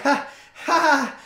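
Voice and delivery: chuckle, then realize it's real; imitating a laughing bystander who sees a real fight; nervous mock laugh. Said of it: "everybody still kinda laughing".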